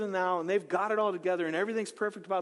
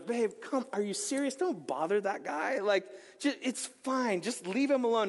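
a lightly voiced husband, higher and brushing her off; skeptical husband; 4 semitones higher than usual. Husband waving off his wife's miracle plan, mock dismissive. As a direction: play the doubting husband telling her not to bother. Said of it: "I picture the husband going".

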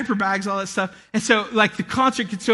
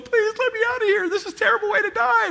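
much higher, hammed-up cry for help; mock desperate plea; 13 semitones higher than usual. Someone trapped in the crowded tide pool, high mock panic. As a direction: squeal like you're smothered in the crowd.

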